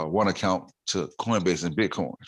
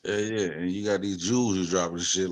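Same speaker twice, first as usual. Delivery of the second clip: usual speaking voice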